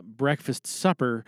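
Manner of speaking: ordinary talk, nothing marked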